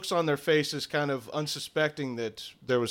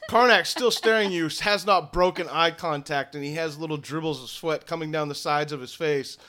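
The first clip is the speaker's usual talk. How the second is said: ordinary delivery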